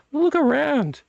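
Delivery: high excited urging; emphatic. Real, not acted. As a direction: look around, high and insistent. Urging viewer to look at shelves.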